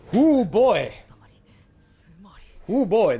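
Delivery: a cheeky, impressed ooh. Real, not acted. Reacting to character designs.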